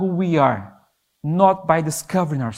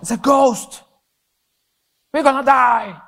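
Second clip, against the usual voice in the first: higher, frightened cry of terrified disciples; panicked shouting; 8 semitones higher, 4 dB louder than usual. Retold or imitated. Preacher voices disciples in the storm shouting in mild mock fear. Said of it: "they shouted".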